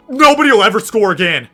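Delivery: high, loud boast; triumphant shout. Real, not acted. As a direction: shout it like an unbeatable champion. Same words shouted after great pick.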